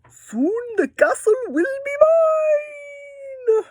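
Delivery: very high, slow villain drawl; scheming dragon. Acted. Teacher voicing the dragon in a picture book, high and slow. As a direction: stretch it out like a gloating dragon. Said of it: "The dragon says".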